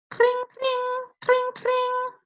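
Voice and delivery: very high ringing clings; bicycle bell. Retold or imitated. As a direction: ring out like a bell. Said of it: "What does a bicycle bell sound like?".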